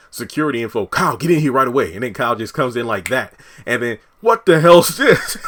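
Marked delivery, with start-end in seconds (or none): 0.9-1.9 snapping order. Retold or imitated. 4.2-5.4 loud, high, startled "what the hell" from a man walking in; startled demand. Retold or imitated. Kyle barging in on Donald, a loud high startled demand.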